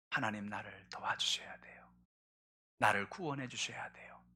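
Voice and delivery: breathy whisper of a plea; hushed pleading whisper; whispered prayer. Acted. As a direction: whisper your cry for rescue.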